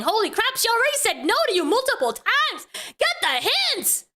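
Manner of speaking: high-pitched, incredulous scolding; exasperated. Real, not acted. Streamer scolding a man who won't take no, exasperated. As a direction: scold him in disbelief, voice swooping up.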